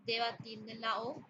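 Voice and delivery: normal talking voice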